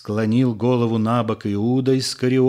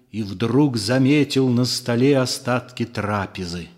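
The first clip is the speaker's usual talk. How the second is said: usual speaking voice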